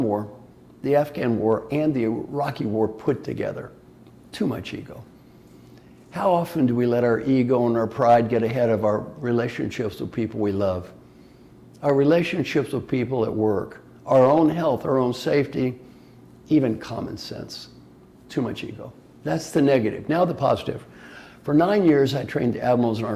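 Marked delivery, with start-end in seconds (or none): 4.3-5.0 soft, breathy under-the-breath remark; quiet aside. Real, not acted. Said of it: "punchline on ego". 18.3-18.9 soft, low under-the-breath repeat; quiet aside. Real, not acted. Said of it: "repeated punchline on ego".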